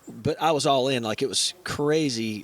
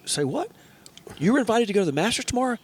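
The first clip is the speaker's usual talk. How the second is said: can't believe what he heard; surprised coworker; 9 semitones higher than usual. Retold or imitated. Coworker hears he turned down the Masters, disbelieving. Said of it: "he said".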